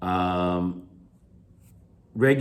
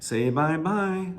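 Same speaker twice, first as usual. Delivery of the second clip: slow, high lilt on "Say bye-bye"; sing-song; 7 semitones higher, slower than usual. Shown as a demonstration. Teacher showing how parents talk to children, sing-song. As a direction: chant "Say bye-bye" like a little song. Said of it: "sounds like a song".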